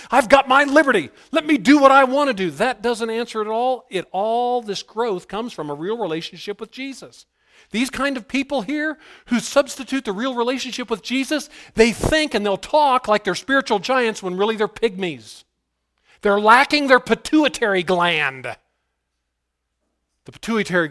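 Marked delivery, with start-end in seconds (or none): 0.1-2.5 loud, high shout demanding to be let alone; defiant libertine. Acted. Preacher voicing a libertine claiming his liberty, defiant shout. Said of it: "Being a libertine". 2.5-18.6 high-pitched, driving pulpit rebuke; full-force preaching. Real, not acted. Preacher exposing would-be spiritual giants to the church, forceful preaching.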